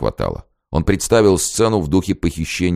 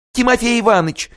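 very high excited call; joyful cry. A narrator's reading. Zina sees her beloved and cries his name, very high.

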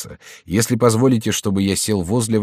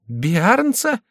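much higher questioning pitch; astonished echo; 12 semitones higher than usual. A narrator's reading. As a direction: repeat the name in disbelief. Chicot echoes the name, astonished.

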